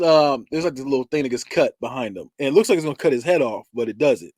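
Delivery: normal talking voice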